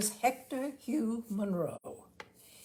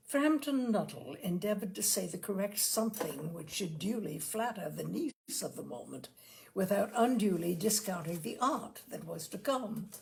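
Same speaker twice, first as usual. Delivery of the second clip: usual speaking voice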